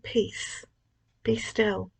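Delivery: soft, mostly voiceless hush; quieting your own worries, hushed. Acted. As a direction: murmur it gently to yourself. Speaker repeats it to herself.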